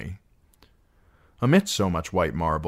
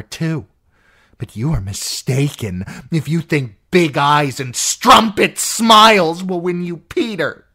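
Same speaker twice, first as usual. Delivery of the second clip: possessive rage building; an aunt claiming Peter as hers; jealous, furious aunt; 9 semitones higher, 9 dB louder than usual. Acted. Lysa's jealous tirade.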